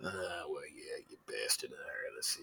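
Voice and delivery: half-whispered grumble to himself; muttering shopkeeper. Acted. Shopkeeper muttering to himself while eyeing a customer, whispered. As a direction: breathe the words, barely voiced, as an old shopkeeper.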